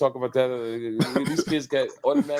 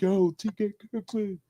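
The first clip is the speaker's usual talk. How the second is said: high, excited call of his name; thrilled fan hailing him from across the room; 10 semitones higher than usual. Retold or imitated. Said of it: "they're like".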